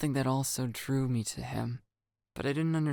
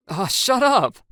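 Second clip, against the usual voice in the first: loud, high shut-up; loud retort; 11 semitones higher, 12 dB louder, slower than usual. Acted. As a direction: shove back loudly. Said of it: "how I think a boy should act".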